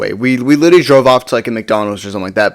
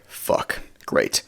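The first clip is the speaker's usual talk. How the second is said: under-the-breath, much lower and softer curse; muttered dread; 12 dB quieter than usual. Retold or imitated. Seeing the cop walk over, muttered sinking dread. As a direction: let it slip out barely voiced, deflated.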